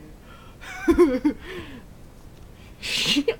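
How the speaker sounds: loud high burst of laughter; laughing. Real, not acted. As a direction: crack up at the monkey. Laughs at singing monkey.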